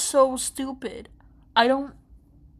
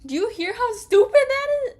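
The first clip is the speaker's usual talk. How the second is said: much higher, louder and fast, cartoonishly outraged; hammed-up disbelief, pitched way up; mock shrill exasperation; 11 semitones higher, 9 dB louder, faster than usual. Mocking the character's question, in a hammed-up high voice. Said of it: "But, like, for real".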